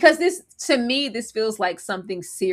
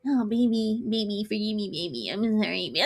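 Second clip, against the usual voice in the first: put-on much softer whimpered baby; mock whimpering apology; 5 dB quieter than usual. Man whimpering sorry to his girlfriend, mocked. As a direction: fade into a pathetic plea. Mocking Rick's begging.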